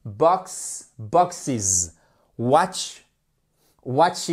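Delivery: BOXES and WATCHES with an added iz syllable; clear -iz plural. Shown as a demonstration. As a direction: sound out the iz ending of BOXES, WATCHES. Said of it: "on fait bien le is".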